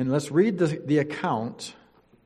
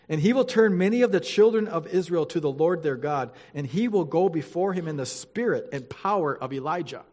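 louder, formal Bible reading voice; scripture reading; 3 semitones higher, 4 dB louder than usual. Acted. Pastor reading Luke to the church, formal and solemn. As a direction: read it out to the congregation with weight.

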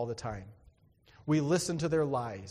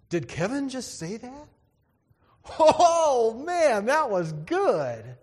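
higher and much louder, stretching out "man, that was good" slowly; amazed friends; 9 semitones higher, 8 dB louder, slower than usual. Retold or imitated. Friends in study hall amazed that shy Kevin spoke, loud high delighted disbelief. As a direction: play the stunned friends cracking up at Kevin's joke.

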